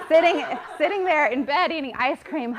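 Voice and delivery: giggling confession about ice cream in bed; laughing. Real, not acted. Laughing at her own confession.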